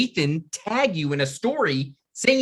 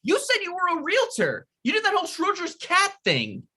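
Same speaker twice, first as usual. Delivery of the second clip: indignant accusation. Acted. Accusing him of lying.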